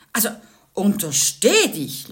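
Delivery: grandma calling from the living room, loud warning; calling from the other room. A narrator's reading. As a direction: warn her off from the next room. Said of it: "rief die Oma aus dem Wohnzimmer".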